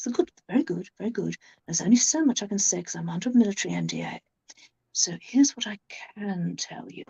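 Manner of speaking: hushed, condescending conman confiding military secrets; patronizing whisper. Retold or imitated. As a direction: whisper it like you're sharing a secret, smug and patronizing. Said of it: "if he speaks very nicely and quite sort of patronizing sort of way".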